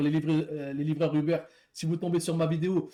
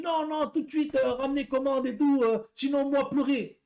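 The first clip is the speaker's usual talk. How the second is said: high, slowed broken French in a put-on foreign accent; mock foreign accent; 8 semitones higher than usual. Driver mimics the angry customer on the phone, mock foreign accent. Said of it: "je vous fais l'accent".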